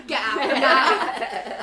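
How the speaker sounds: slow, loud, laughing command; mock fierce order. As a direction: drag out each word like a furious flatmate. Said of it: "the second time you came in".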